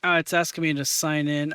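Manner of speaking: normal talking voice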